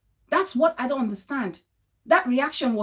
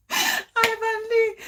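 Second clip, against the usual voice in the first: mock wail; 8 semitones higher, much slower than usual. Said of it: "laughing wail imitation".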